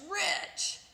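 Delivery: plain reading voice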